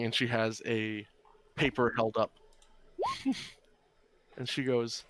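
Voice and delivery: ordinary talk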